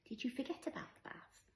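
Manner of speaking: put-on small, high, soft child voice; little child's voice. A narrator's reading. Five-year-old Skye asks her mother about the bath, little voice. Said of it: "asked Skye in her little voice".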